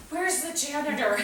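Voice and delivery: put-on boy eager to find the janitor; eager little boy. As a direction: blurt it out like a relieved kid. Boy eagerly picks the option.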